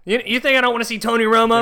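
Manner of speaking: put-on high, fast country-accent rant; hammed-up country accent. Homeowner who wants the Cowboys, indignant country accent. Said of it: "went through so many different accents".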